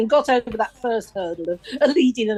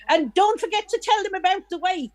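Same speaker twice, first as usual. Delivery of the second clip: higher-pitched interruption from the front row; imitating the widow; 8 semitones higher than usual. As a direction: butt in eagerly with a reminder.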